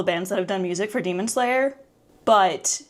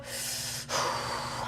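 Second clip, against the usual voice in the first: shaky excited exhale; 12 dB quieter than usual. Real, not acted. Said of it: "I need to breathe".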